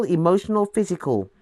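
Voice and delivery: ordinary delivery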